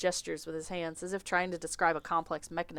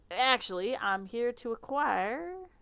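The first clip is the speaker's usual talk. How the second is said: slow, hesitant Southern; light Southern, trailing slowly; Southern accent; 5 semitones higher, slower than usual. Acted. Victor starting to state his business, slow Southern. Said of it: "I don't know why he's Southern".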